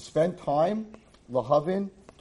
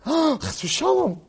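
mock horror gasp; 11 semitones higher, much slower than usual.

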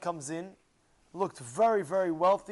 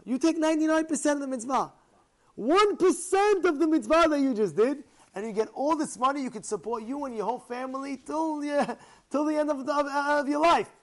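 high, loud, pleading sales pitch; insistent bargaining; 7 semitones higher, 5 dB louder than usual. Acted. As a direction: push the last deal hard. Rich man's last pushy offer.

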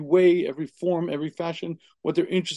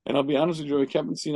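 ordinary talk, nothing marked

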